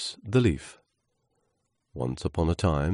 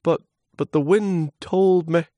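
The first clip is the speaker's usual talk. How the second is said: very high, stammering voice; protesting little leaf; 13 semitones higher, 6 dB louder than usual. Acted. Leaf citing the wind to scornful trunk, high protest.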